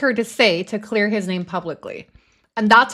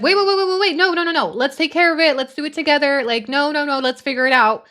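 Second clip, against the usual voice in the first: imitated panicked pleading; 4 semitones higher, faster than usual. Commentator imitating the woman begging him not to post, panicked. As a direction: mimic her frantic plea to stop. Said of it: "you can tell she's like".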